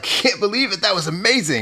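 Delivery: high-pitched mock amazement. Retelling the helicopter guy's reaction, mock-amazed. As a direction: imitate someone thrilled by what they saw. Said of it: "like, into it".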